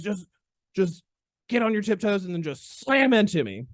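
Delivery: high, halting South Carolina imitation; mock South Carolina mentor, leaning hard on the key word; South Carolina accent. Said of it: "He was from South Carolina".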